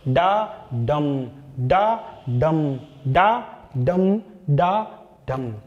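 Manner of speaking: slow, separated beats, light डा then weighted डम; drawn-out iambic beat on डा डम; iambic rhythm. Shown as a demonstration. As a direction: chant डा डम slowly as unstressed-stressed pairs. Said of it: "अब इसकी लय कैसे चलती है?".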